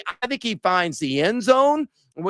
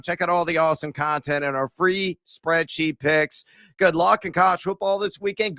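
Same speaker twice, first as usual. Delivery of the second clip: ordinary talk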